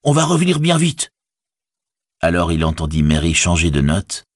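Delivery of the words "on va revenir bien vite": high-pitched, hurried call. A narrator's reading. Sam reassures his pony on the road, calling out. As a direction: call it out high over the horn. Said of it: "cria-t-il".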